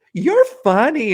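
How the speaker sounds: hammed-up old waitress voice; high old lady. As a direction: coo it like a sixty-year-old waitress.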